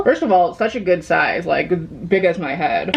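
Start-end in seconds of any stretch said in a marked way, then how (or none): none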